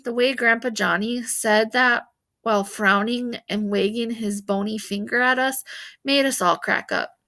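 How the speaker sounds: ordinary delivery, nothing marked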